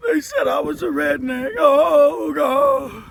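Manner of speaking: mock the crybabies with a fake wail; exaggerated blubbering complaint; mock crying. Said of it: "You don't see me crying".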